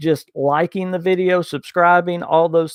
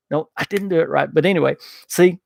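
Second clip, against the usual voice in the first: ordinary talk, nothing marked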